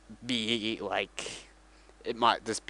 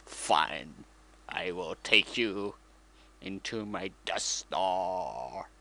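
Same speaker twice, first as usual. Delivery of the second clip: much lower, slow villain voice; menacing Darth Vader; 6 semitones lower, much slower than usual. Retold or imitated. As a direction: drop low and slow, full menace. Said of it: "And he's like".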